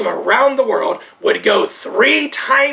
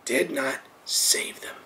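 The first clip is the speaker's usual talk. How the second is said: stage whisper; 8 semitones lower, 12 dB quieter than usual. Real, not acted. Preacher to his congregation, in a hushed whisper. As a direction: drop to a slow, breathy whisper.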